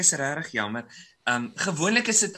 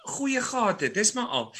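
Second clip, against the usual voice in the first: ordinary delivery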